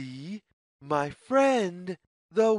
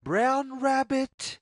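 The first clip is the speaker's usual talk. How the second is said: sing-song call; 7 semitones higher than usual. A narrator's reading. Calling out to the rabbit.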